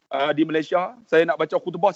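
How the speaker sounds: normal talking voice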